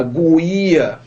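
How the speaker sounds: GUIA stretched with the U sounded, "goo-EE-a"; wrong pronunciation. Shown as a demonstration. Teacher mimicking a wrong request for a guia, U pronounced. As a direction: say GUIA wrongly as a slow GWEE-a. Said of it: "você não fala guia".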